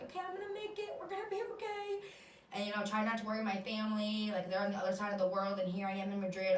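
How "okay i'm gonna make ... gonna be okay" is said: high, soft reassuring voice; self-reassurance. Retold or imitated. Sick in hospital, reassuring herself, high and soft. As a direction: comfort yourself softly. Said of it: "I was like".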